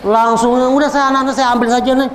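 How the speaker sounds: high, fast indignant complaint from a mother; imitating an upset parent. Parent complaining about the teachers at the pesantren, indignant. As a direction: rattle it off like an outraged parent.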